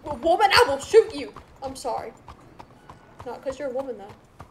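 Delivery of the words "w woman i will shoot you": mock threat. Threatening angry NPC.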